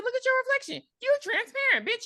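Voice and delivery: high-pitched mock alarm; mock horror. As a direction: play up the brother's appalled discovery. Mock brother's horrified discovery.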